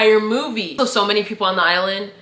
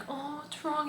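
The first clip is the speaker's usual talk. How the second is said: soft, high cooing concern; mock sympathetic concern; 8 semitones higher, 15 dB quieter, slower than usual. Friends checking on the crying man, mock tender concern. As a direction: imitate friends gently consoling him.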